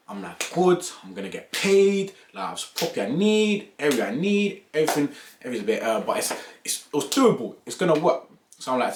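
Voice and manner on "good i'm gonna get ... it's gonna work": excited. Retold or imitated. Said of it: "excited inner voice".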